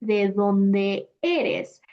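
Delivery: each vowel pulled apart slowly; careful textbook pace; slow separated vowels. Shown as a demonstration. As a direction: say it word by word, vowels kept separate. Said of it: "What's the point of separating e, e".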